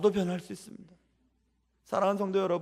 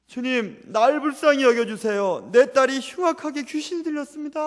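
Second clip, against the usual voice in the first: loud, high pleading cry; desperate mother's loud plea; crying out to the Lord; 5 semitones higher, 6 dB louder than usual. Retold or imitated. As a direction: beg the Lord at full voice for your daughter. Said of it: "외치게 됩니다".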